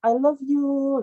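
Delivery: high, drawn-out gushing K-pop confession; K-popper style. Retold or imitated. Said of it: "ala-ala, eh, K-popper ya". (gaya manja ala K-popper)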